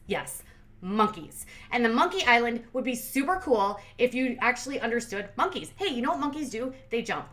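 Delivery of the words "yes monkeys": sarcastic. Real, not acted. Mocking the monkey trip.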